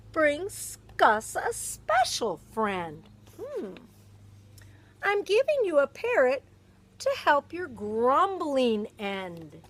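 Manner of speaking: lively, expressive picture-book read-aloud; animated storybook narration; reading to children. Acted. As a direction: read it like storytime for a little one.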